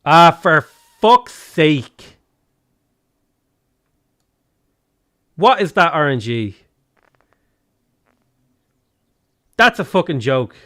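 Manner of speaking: loud, exasperated outburst; fed-up, forceful swearing; angry cursing. Real, not acted. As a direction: curse your rotten luck out loud. Cursing bad luck in game.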